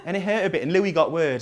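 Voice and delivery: usual speaking voice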